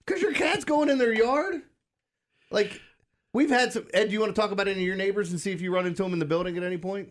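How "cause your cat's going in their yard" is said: high, slow disbelief; mock amazement; incredulous. Real, not acted. Amused disbelief.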